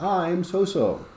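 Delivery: lightly high student answer; a hint of a student. Student answering the question, lightly high. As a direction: answer like a young pupil. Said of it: "And then, like".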